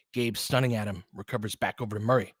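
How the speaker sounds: ordinary talk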